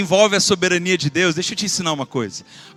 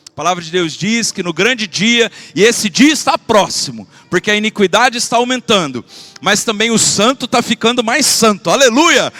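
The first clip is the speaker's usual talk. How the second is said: loud, driving sermon voice; full-force preaching; 3 semitones higher, 6 dB louder than usual. Acted. Pastor urging his congregation in a loud preaching voice.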